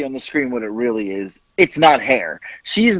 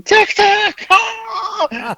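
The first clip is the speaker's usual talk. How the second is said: very high squealed mock cackle; imitating hysterical laughter; much slower than usual. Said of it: "she was laughing, going".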